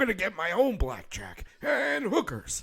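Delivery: raspy robot wisecrack, pitched high; Bender voice. Retold or imitated. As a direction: channel Bender from Futurama. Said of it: "kinda sounds like Bender from Futurama".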